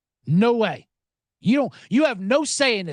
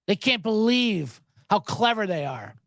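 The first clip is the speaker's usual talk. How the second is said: usual speaking voice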